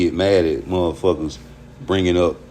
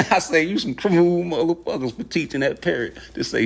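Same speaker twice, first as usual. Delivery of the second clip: higher voice, laughing through the words; 8 semitones higher than usual. Real, not acted. Streamer amused by the foul-mouthed parrot, laughing. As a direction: talk while still cracking up.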